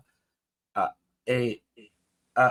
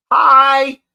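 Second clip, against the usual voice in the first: sudden loud greeting; 10 semitones higher, 12 dB louder than usual. Retold or imitated. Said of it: "and he'll go".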